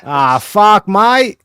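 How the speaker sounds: put-on Australian accent. Aussie bit continues.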